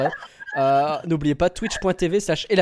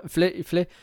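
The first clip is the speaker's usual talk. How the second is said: normal talking voice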